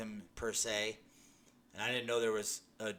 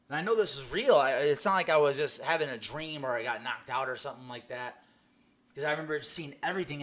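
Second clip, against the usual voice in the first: louder, urgent insistence that it was real; earnest louder testimony; emphatic insistence; 3 semitones higher, 9 dB louder than usual. Real, not acted. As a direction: press hard that this really happened. Insisting his vision was real.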